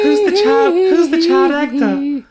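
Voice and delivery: put-on high, childlike star voice. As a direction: squeal it in a hammed-up falsetto. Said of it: "he was just like".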